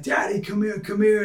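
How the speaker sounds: put-on high little boy calling his dad; a child pleading for daddy to come; toddler voice. Son calls busy father.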